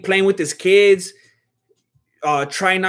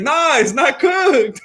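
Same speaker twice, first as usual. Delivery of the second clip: slow, higher insistence; insistent manager; 9 semitones higher, much slower than usual. Retold or imitated.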